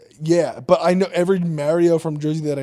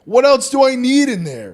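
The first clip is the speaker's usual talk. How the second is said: raised, high rhetorical question; emphatic exclamation; 7 semitones higher, 6 dB louder than usual. Real, not acted. Podcaster defending his bare bathroom shelves, loud and emphatic.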